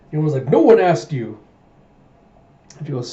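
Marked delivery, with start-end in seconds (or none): 0.5-1.4 loud, high-pitched snap; angry retort. Acted. Princess retorting to her teasing guard, angry. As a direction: fire back in mock outrage.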